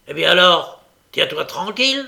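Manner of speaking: gruff, forceful warning; growling command. A narrator's reading. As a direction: bark it like an order to a dog. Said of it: "en grondant comme il avait l'habitude".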